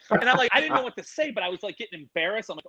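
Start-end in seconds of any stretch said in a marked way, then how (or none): none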